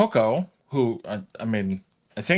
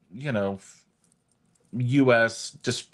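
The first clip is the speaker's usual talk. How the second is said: normal talking voice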